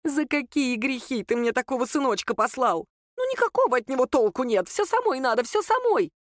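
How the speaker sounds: high-pitched complaining mother, climbing at the end; exasperated lament.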